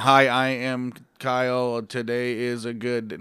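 slow, wooden word-for-word read, Australian accent; stiff straight reading. Shown as a demonstration. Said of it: "if you're just straight reading".

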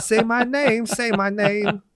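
put-on German accent. Mock German singer crooning the song, high German accent. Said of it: "are you doing German?".

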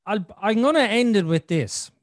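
ordinary talk, nothing marked

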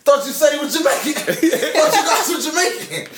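high, loud jeering through laughter; laughing, high-pitched mock disbelief; mocking hecklers. Mocking crowd at soccer embarrassment.

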